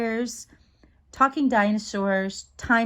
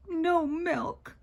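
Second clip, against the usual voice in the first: high, softer echo of a complaint; put-on voice of a younger sister; child lamenting in a higher voice; little sister; 7 semitones higher, 4 dB quieter than usual.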